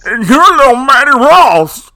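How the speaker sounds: loud, slow, high introduction; hammed-up big introduction; announcer call. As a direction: boom it out like a ring announcer. Teasing announcement of a name.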